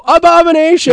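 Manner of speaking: high shouted crowd response; imitating a few fans yelling. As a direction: scream the band name back. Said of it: "five or ten people go".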